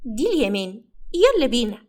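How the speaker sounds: lightly voiced small girl; a hint of childlike voice; little girl. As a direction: answer like a child sealing a deal. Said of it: "لؤلؤة خبطت كفها في كفه".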